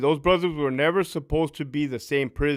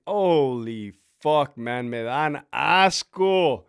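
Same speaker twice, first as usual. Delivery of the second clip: stunned awe; 5 semitones lower, much slower than usual. Retold or imitated. Stunned staff at the gate.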